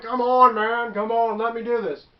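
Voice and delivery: imitated pleading. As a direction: play a buddy pushing for another go. Retold player begging for another try.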